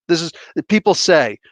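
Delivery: ordinary talk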